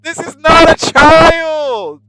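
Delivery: high, loud, incredulous shout; mock outrage. Player objecting to the child character, high outraged shout. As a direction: shout in disbelief, stretching the last words.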